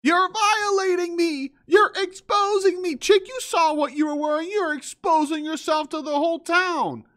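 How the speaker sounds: very high, whiny mockery; mocking woman voice. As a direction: whine her words back at her.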